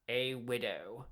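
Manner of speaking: low, slow and hushed; soft melancholy. A narrator's reading. Barrister to jury, soft and melancholy. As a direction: let the word widow sink with sorrow. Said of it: "in a soft and melancholy voice".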